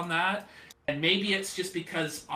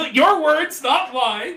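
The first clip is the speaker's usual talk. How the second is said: laughing; 11 semitones higher, 11 dB louder, slower than usual. Real, not acted. Laughing disclaimer.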